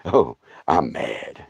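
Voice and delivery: low, slow sulky thumb; grumpy thumb voice. Retold or imitated. The injured thumb refusing to be used, low sulky grumble. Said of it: "that's about as loud as it got".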